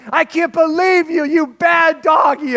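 high, loud, slow mock scold of the bad doggy. Dad scolding the little dog while the kids protest, mock angry shout. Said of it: "and I'm like".

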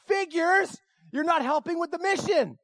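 high-pitched mock annoyance; exasperated. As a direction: blame your partner for the failed mission. Annoyed thought at wife's failure.

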